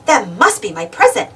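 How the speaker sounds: usual speaking voice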